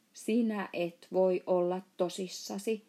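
ordinary delivery

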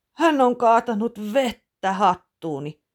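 father laments his ruined hat, loud groaning. Acted. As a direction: moan it in dismay. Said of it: "voihkaisee isä".

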